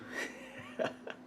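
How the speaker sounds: soft breathy laugh after teasing; a quick amused laugh; short laugh. Real, not acted. Teacher teasing students, short laugh. Teasing viewers about the answer.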